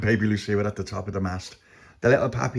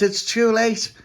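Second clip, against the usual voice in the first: high, raised wail of words; dismayed character; 13 semitones higher, 4 dB louder, slower than usual. A narrator's reading. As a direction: cry it out high. Tony answers warning.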